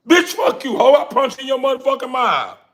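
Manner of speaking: very high, loud hammed-up yell; mock enraged shout. Man yelling back at the woman who scolded him, mock fury. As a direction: blow up at her.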